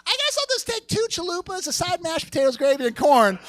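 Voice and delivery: high, polite, breezy ordering voice; suddenly sane customer. Retold or imitated. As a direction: act out a man instantly reasonable and agreeable. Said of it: "the dude just snaps out of him".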